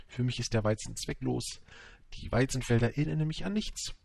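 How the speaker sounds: usual speaking voice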